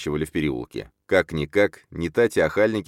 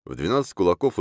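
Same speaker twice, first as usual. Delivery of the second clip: usual speaking voice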